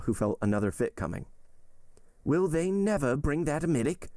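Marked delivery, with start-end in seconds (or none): 2.2-4.1 high, strained and pleading; desperate plea. Acted. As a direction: beg for help in pain. Patient in pain pleading.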